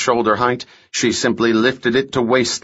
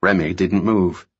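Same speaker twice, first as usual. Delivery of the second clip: ordinary delivery, nothing marked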